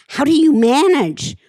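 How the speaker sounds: retold amazement, pitch leaping up; imitating amazed friends. Quotes amazed people asking her.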